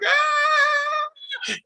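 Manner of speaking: high mock scream; retold scream. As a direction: shriek it like a horror heroine. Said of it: "she screams".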